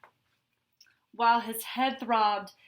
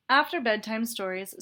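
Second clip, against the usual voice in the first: ordinary delivery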